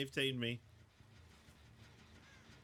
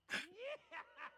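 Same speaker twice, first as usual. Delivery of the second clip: high giggle; 11 dB quieter than usual. Real, not acted.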